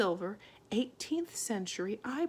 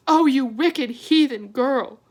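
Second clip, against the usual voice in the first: horrified mother; 6 semitones higher, 14 dB louder than usual. A narrator's reading. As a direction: cry out in horror. Homily horrified.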